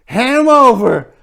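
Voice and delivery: high, loud shouted orders; shouting. A narrator's reading. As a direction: yell it like a jittery robber.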